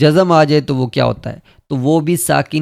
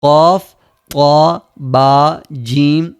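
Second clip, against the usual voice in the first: louder, slow, each letter punched; letters called out one by one; 3 dB louder, much slower than usual. Shown as a demonstration. Teacher dictating the five letters, loud and slow. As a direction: call each letter out firmly for the class. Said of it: "پھر سے ایک دفعہ میں بولتا ہوں".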